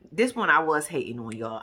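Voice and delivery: ordinary talk, nothing marked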